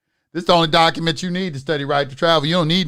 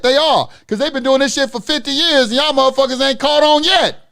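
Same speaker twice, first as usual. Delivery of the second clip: higher, louder, real exasperation; heated rant; 7 semitones higher, 4 dB louder than usual. Real, not acted. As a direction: rail at the audience to wake up. Rant against the court system.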